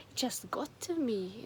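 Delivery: usual speaking voice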